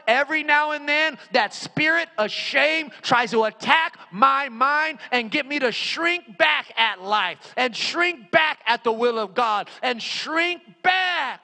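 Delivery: impassioned pulpit cry, stretched key words; full-force preaching. Real, not acted. Preacher confesses his past and lifts the congregation's shame, full-force preaching. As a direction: drive each phrase home to the crowd.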